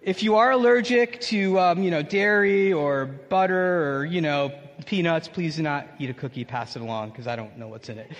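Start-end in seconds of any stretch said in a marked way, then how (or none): 5.3-8.1 softer, lower aside; quiet aside. Real, not acted. Pastor warning allergic listeners, soft aside. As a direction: drop it to a low aside.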